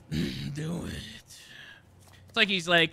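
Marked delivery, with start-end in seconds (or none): 0.1-1.4 low, breathy growled impression; mock breathless villain. Player mocking how Wesker talks, out of breath. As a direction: imitate a winded menacing villain. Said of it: "like, out of breath".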